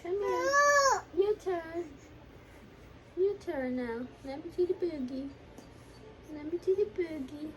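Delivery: fake-cry in sympathy, hamming it up; mock crying baby talk. Mother pretend-crying over the baby's boogie, high whimper. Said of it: "your fake tears".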